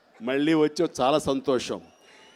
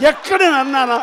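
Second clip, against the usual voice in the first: emphatic raised challenge; 6 semitones higher, 4 dB louder than usual. Real, not acted.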